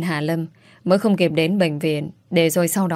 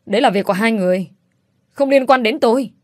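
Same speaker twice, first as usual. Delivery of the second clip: rising to a higher, louder cry, fast at first; helpless shout; 4 semitones higher, 3 dB louder than usual. A narrator's reading. Hà Anh shouting at her uncle and aunt in helpless despair. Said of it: "Hà Anh hét lên trong bất lực".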